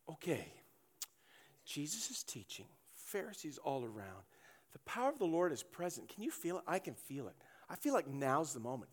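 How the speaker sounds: hushed secret planning; conspiratorial hush. Acted. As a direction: plot it quietly so the Pharisees don't hear. Friends whispering plan.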